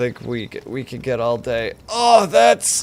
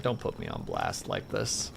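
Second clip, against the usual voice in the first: much lower, softer mock-grumpy voice; a glaring friend muttering low; imitating Haya; 8 dB quieter than usual. Streamer voicing Haya's glare at being exposed, low and grumbling. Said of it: "give me a glare and be like".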